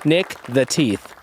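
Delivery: upbeat podcast host welcome; show host intro. Acted. Host welcoming listeners back to the show, upbeat announcer read.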